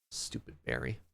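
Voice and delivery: low muttered grumble; grumbling. Real, not acted. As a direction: grumble it under breath. Grumbling after hurt.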